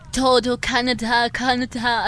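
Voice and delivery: put-on drawn-out gringo-accented Spanish call; stretched vowels in a mock gringo accent. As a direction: imitate a gringo fare collector calling stops, very slowly. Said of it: "conocí a un cobrador gringo".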